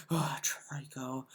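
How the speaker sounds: whispered, slow; mock whisper. Mocking Phil muttering at his game companion, whispered. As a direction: breathe it out, slow.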